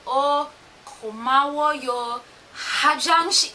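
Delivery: every little sound of "고마워요" spelled out; over-pronounced Korean. Retold or imitated. Learner trying to say every little thing, over-pronounced Korean. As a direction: carefully sound out each part of "고마워요". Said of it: "trying to say every little thing".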